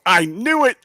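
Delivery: high, loud gotcha cry; a burst of vindicated glee; triumphant exclaim. Retold or imitated. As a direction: crow it like you were proven right. Triumphant answer to wife's question.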